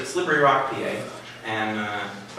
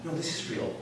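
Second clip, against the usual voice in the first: hushed insistence; 4 semitones higher, 11 dB quieter than usual. Retold or imitated. Campers correcting him.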